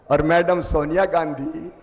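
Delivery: normal talking voice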